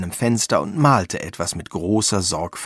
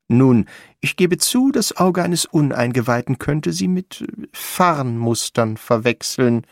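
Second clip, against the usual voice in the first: Ferdinand Frost, defensive; 5 semitones higher than usual. Acted. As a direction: concede it loftily and carefully.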